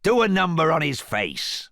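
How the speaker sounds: loud, high shout of a brute; rough Cyclops yelling for a beating; Cyclops crying out. A narrator's reading. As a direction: bellow the order at the prince. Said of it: "cried the Cyclops".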